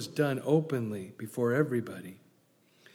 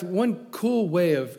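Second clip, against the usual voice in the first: normal talking voice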